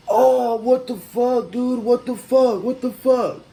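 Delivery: slow, high, loud stunned outcry; shocked driver. Retold or imitated. Driver who got punched cries out in shock, slow and high. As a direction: voice the punched guy reeling.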